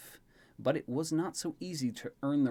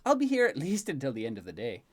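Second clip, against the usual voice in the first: teasing Elaine; 2 semitones higher, 3 dB louder, faster than usual. Acted. Elaine joking with the birthday boy, teasing. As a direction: tease him fondly as Elaine.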